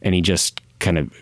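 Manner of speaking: ordinary delivery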